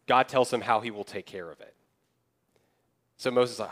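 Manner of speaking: normal talking voice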